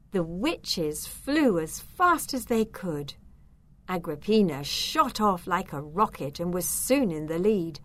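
ordinary delivery, nothing marked

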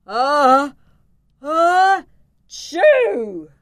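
high, loud building "ah-ah-choo"; big acted sneeze. A narrator's reading. Dragon tickled by the dust, sneezing loudly. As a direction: build up gasps, then burst into a sneeze. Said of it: "he needed to sneeze".

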